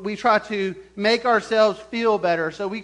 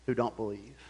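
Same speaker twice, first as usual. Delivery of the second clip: ordinary delivery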